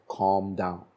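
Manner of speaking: low, soft calming command; quiet, settled instruction. Retold or imitated. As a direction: tell them gently, voice dropped. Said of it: "lowering my voice".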